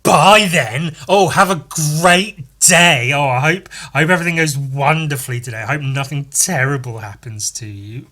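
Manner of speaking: loud mock-sweet farewell; sarcastic goodbye. Cursed man sarcastically seeing his partner off to work. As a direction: wish her well with heavy sarcasm. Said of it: "And then he goes".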